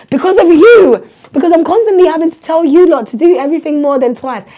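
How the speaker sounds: loud, high teacher's rant; re-enacted fed-up telling-off; exasperated scolding. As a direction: blame the class at full volume. Acts teacher scolding pupils.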